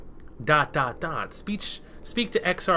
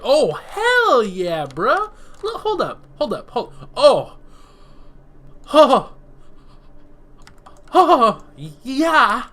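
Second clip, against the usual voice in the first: loud, much higher whoops of delight; thrilled shouts; 8 semitones higher, 9 dB louder, much slower than usual. Real, not acted. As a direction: yell with real glee at the reveal. Thrilled at a disguise reveal.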